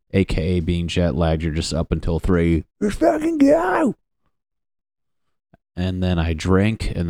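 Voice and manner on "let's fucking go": very high excited shout; hyped shout. Retold or imitated. Jet lagged comedian yelling wired on no sleep. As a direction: yell it like you're wired at 3 am.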